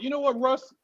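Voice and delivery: normal talking voice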